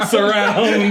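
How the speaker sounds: high laughing words. Real, not acted. Laughing joke.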